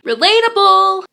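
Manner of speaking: high, loud mock cheer of relatable; catchphrase shout. Vlogger shouting her running joke: high loud relatable. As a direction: belt out relatable as a silly catchphrase.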